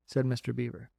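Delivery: plain reading voice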